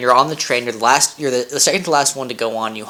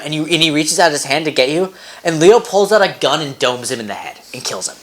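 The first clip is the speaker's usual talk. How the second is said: usual speaking voice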